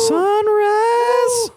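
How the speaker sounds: high, loud excited cry; excited shout. Real, not acted. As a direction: shout it out, hyped. Hyped reaction to studio name.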